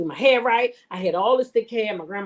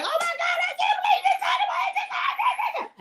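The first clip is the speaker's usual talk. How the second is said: high, laughing shriek of disbelief; yelling in dismay; 3 dB quieter than usual. Retold or imitated. Daughter shrieking at her dad after failing the test. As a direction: shriek it, half laughing, half horrified. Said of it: "I just yelled".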